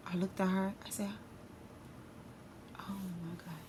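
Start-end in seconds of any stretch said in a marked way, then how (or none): 2.8-3.5 very soft, under the breath; hushed dismay. Retold or imitated. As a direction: let it slip out almost inaudibly. Her horrified reaction to sister telling.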